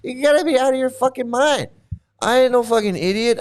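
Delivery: high, heated disbelief; indignant. Real, not acted. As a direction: scoff at the idea hotly. Indignant rant.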